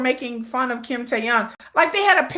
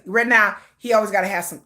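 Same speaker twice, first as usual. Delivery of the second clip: normal talking voice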